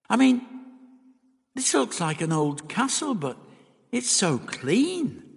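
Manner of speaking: put-on high-pitched, animated young companion; uneasy Dodo. A narrator's reading. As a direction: lift the voice and draw out the last word. Dodo uneasy about the castle.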